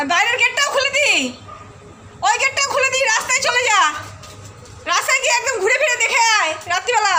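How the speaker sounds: high, playful calling out; calling the dog. Real, not acted. Owner calls her naughty dog Leo back inside, playful high calls. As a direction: call the dog in, teasing.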